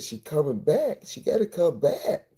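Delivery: put-on will the baby back to life; desperate. Desperate father over his limp baby.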